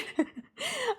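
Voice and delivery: a sudden high, breathy giggle. Real, not acted. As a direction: burst into a squeaky laugh. Recalling being so polite.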